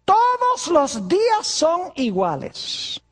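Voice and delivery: slow, drawn-out objecting churchgoer; lightly voiced doubter, dragging the words; a hint of a whiny objector; mock objector. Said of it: "Algunas personas dicen".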